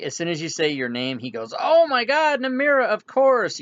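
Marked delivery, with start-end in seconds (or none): 1.6-3.6 very high, loud exclamation; astonished, joyful outburst; overjoyed recognition. Acted. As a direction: cry out as it all comes rushing back. Master suddenly recognizes his apprentice.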